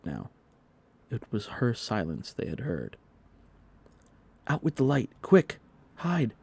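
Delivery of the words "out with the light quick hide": high, loud urgent whisper-shout to hide; urgent cry. A narrator's reading. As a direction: hurry them to hide. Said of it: "cried John".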